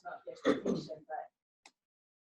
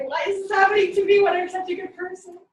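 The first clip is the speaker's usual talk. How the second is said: high, loud, exaggerated poor-me wail; whiny self-pity; 13 semitones higher, 16 dB louder than usual. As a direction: ham up a self-pitying complaint. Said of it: "have a negative moment and say".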